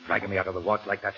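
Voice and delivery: loud angry rebuke. Acted. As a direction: scold him loudly. Kalanza furious at Harris.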